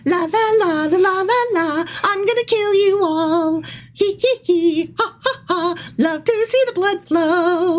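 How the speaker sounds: high, gleeful maniac singsong; gleeful villain. Pretend. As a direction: cackle it like a gleeful killer. Villain voice reading bible.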